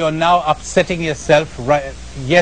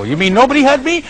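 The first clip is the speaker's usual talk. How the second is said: high, fast, disbelieving question; mock-shocked audience member; incredulous listener; 7 semitones higher, much faster than usual. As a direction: play the baffled objector. Voicing a doubting listener.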